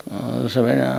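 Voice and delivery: ordinary delivery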